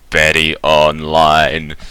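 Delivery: slow, monotone robot announcement; deliberate robot voice, each syllable drawn out; booming robotic voice. Acted. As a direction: intone it like a big robot powering up. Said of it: "this very deep, booming, robotic voice".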